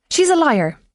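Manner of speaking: high, loud outburst; screamed accusation. A narrator's reading. Widow accusing a woman before the inspector, screaming. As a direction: shriek it out as an accusation. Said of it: "Roisin screamed".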